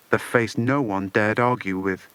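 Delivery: ordinary delivery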